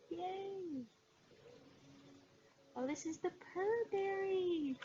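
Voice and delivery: high, delighted squeal of joy; excited. Real, not acted. Showing viewers the Purr Berry kit, she squeals happily. Finding the kit on her list.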